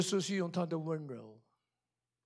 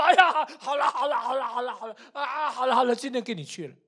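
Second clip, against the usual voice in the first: flustered giving in; 5 semitones higher than usual. Retold or imitated. Mother giving in to crying daughter.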